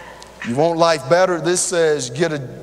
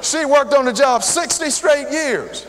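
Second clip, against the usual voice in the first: preacher's raised, emphatic repeat; repeated for the back row; 7 semitones higher than usual. Shown as a demonstration. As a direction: say it again so the back hears. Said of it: "You hear me in the back?".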